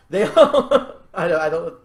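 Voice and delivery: loud, high laugh breaking the sentence. Real, not acted. Speaker loses the thread while explaining the plot, laughing. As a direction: crack up and lose the sentence.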